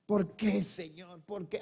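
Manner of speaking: hushed, half-cried plea to God; mock despairing plea. Preacher imitating a weeping believer asking God why, subdued despair. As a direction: act out despair softly, fading away. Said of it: "Con gritos de desesperación".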